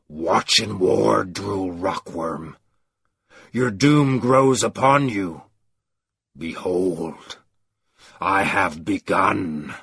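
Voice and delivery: slow, solemn pronouncement of doom. A narrator's reading. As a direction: intone it like a curse.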